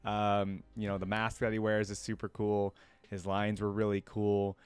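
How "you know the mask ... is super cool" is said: normal talking voice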